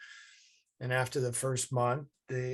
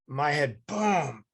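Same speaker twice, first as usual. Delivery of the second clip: ordinary talk, nothing marked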